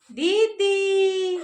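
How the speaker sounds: word demo for parrot. Shown as a demonstration. Prompting the parrot with both words, drawn-out. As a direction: hold the vowels of दीदी and Mithu. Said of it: "मिठू बोलो".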